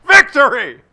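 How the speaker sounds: loud, high laughing cry of victory; delighted at the absurd win; laughing triumph. Real, not acted. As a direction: crow the win through laughter. Game won against all odds.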